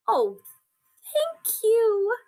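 high, heartfelt thanks; happy, grateful mother. A narrator's reading. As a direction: lift the thanks high with relief. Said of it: "She's so happy".

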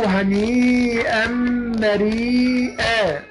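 هنيئا مريئا drawn out slowly, the ر of مريئا light and thin; slow model reading of هنيئا مريئا with a light ر; tajweed example. Shown as a demonstration. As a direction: stretch each word of هنيئا مريئا, keeping the ر thin. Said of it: "أما نقول إيه".

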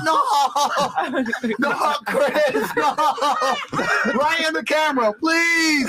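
high, laughing, half-yelled pleading; mock panic attack. Client begging right into the camera, laughing mock panic. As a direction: ham up a man losing it on camera. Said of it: "have a panic attack".

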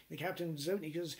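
ordinary talk, nothing marked

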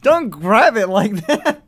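high, loud, slow mock scold; loud playful scolding. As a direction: drag it out and yell it. Telling someone off over skirt.

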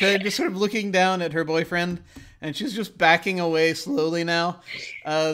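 ordinary delivery

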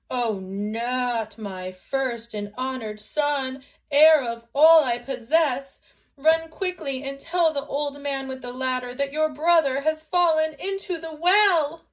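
loud, high cry of alarm, building; alarmed mother. A narrator's reading. Mother sends Chang for the old man, alarmed. Mother hears her son fell.

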